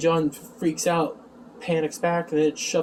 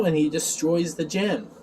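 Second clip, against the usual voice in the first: normal talking voice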